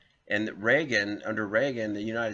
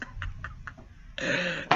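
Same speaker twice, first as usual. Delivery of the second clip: breathy laugh; 9 semitones higher than usual. Real, not acted. Laughing at own blunder.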